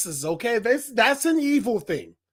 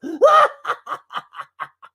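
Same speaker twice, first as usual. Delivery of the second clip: villainous laugh; 7 semitones higher, 8 dB louder than usual. Pretend. Mascot gloating after subscribe plug.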